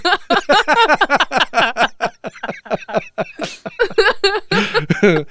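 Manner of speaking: high helpless laughter; long high giggling fit. Real, not acted. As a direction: laugh until you can't speak. Long laugh mid-sentence.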